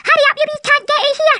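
ordinary delivery

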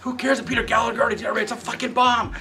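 high-pitched shouted tirade, imitated; hammed-up studio boss berating a director; angry ranting. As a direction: rail at him, high and forceful.

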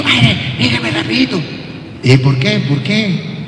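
normal talking voice

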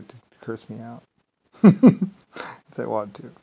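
chuckling through the words; slow, laughing aside. Real, not acted. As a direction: laugh it out, slowly. Laughing aside about Irish.

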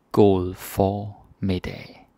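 God formiddag drawn out slowly with stress on god; slow demo. Shown as a demonstration. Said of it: "slow demo".

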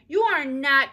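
high, loud flat denial; contradicting. A narrator's reading. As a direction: shut him down. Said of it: "contradicted Ramona".

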